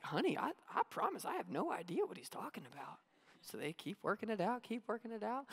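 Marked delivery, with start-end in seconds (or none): 0.0-3.0 much softer, bewildered mom; hushed and puzzled, stammering; baffled mom. Retold or imitated. Mom softly telling dad she has no idea. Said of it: "she's like".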